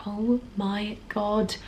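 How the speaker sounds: very slow, quiet mock horror; hushed dismay. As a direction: let the blunder sink in, softly and slowly. Acts out realising their blunder.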